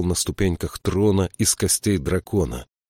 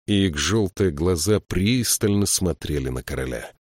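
ordinary delivery

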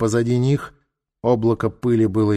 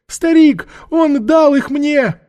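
very high, loud whining cry; frightened old woman; 7 dB louder, slower than usual. Acted. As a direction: plead shrilly under interrogation.

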